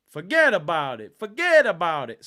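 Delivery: put-on loud New York Italian mobster accent: forget about it; loud mock Italian American gangster accent; Italian Goodfellas accent. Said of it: "my Italian Goodfellas accent".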